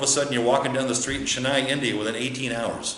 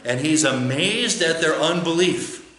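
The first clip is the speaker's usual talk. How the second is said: ordinary talk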